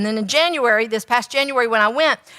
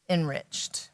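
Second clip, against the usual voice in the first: hushed; 6 semitones lower, 11 dB quieter than usual. Real, not acted. Dramatic pause before key word.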